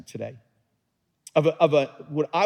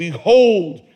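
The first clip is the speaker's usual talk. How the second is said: retold shout; 8 dB louder than usual. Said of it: "he said".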